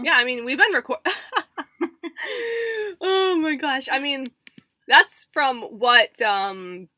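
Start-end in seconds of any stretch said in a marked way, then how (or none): 1.0-3.0 high, soft peal of laughter; burst of laughter. Real, not acted. Cracking up while talking to co-host, high helpless laugh. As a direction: crack up mid-word.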